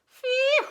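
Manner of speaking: very high loud puff; loud phew. Acted. Goose tired of gathering seeds, big high phew. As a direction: blow out in relief.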